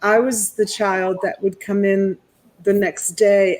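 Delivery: usual speaking voice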